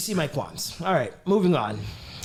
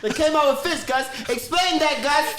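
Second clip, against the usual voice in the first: much higher, louder mock demand; high comic quote voice; 10 semitones higher, 4 dB louder than usual. Echoing the joke to Gus, high mock demand. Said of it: "quoting the bit".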